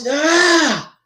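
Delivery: 音调升高的拖长喊叫; 演出来的一声沮丧呼喊，并不真正放开音量; 模仿大喊. Acted. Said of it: "Riddick would just shout".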